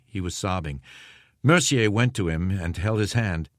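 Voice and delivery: ordinary delivery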